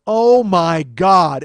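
slow, drawn-out loud outburst; loud exasperation. Retold or imitated. Man typing his reply to her, loudly exasperated. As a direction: stretch every word out at full volume.

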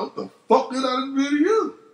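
high, exasperated demand; indignant. Retold or imitated. Retold confronting the friends.

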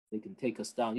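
ordinary talk, nothing marked

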